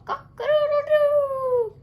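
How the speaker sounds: very high, loud, slow crowing; rooster crow. A narrator's reading. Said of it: "showed off his magnificent call".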